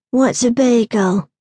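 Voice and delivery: slow, uninterested question; flat, uninterested. A narrator's reading. Boy offered a bagel by a woman, uninterested. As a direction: ask it slowly with little interest. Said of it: "His voice was flat, uninterested".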